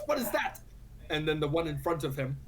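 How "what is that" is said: mock shout. Armored fighter alerting his companions to something overhead, shouting. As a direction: call out a startled question, pitched way up. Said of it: "points out and shouts".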